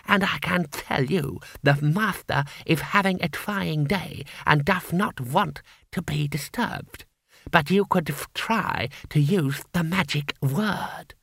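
high, slow smug gargoyle; a condescending little door knocker. A narrator's reading.

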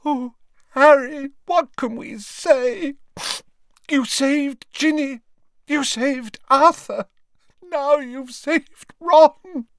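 high, loud, slow crying thanks; overwhelmed mother weeping her gratitude. A narrator's reading. Mrs Weasley weeps gratitude to Harry for saving Ron. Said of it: "she sobbed".

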